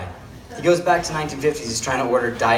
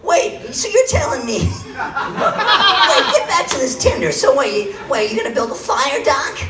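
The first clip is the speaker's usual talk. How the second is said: put-on much higher, excited astonished teen; hammed-up Marty gasping at future tech; Marty McFly; 12 semitones higher, 4 dB louder than usual. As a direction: squeak in disbelief at every word. Improv Marty McFly scene.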